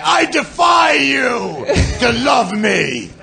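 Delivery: high, slow, drawn-out defiant yell; mock scream at God. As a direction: scream it up at the sky like a wronged lover. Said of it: "Screaming at this guy".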